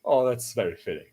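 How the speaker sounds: ordinary delivery, nothing marked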